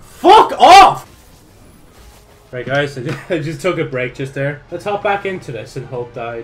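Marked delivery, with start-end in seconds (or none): 0.0-1.1 much louder, higher furious yell at the game; raging shout. Real, not acted. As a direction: blast it out in pure frustration. Dies again, raging.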